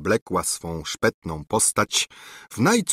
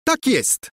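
much higher, pressed emphatic reply; with emphasis. Acted. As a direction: insist on both words, Tak jest, pitched up high and pushed. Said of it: "powtórzyła z naciskiem".

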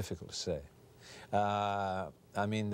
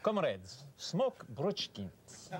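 Russian cigarette ad; 9 semitones higher than usual. Pretend. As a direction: sell Bruchkins like a Soviet announcer. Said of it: "Even in Russia, I did one once about cigarettes".

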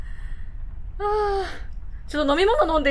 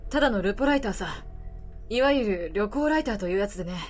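put-on gruff weary older man, low; lower middle-aged man voice; 6 semitones lower, 6 dB quieter, faster than usual. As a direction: speak low like a tired drifter. Voices the reporter.

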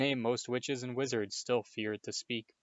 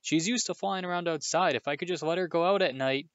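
pleading explanation; 7 semitones higher, 3 dB louder than usual. A narrator's reading. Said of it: "Harry tried, yet again, to explain".

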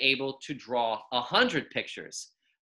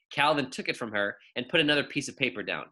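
ordinary delivery, nothing marked

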